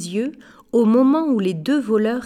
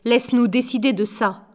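plain reading voice

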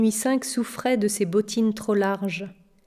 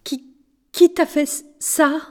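halting, higher, stammered question; gasping shock; 6 semitones higher, 3 dB louder than usual. A narrator's reading. Ruby has seen the boy's tattoo, gasping and stammering. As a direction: stumble on the words, stunned. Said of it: "haleta-t-elle".

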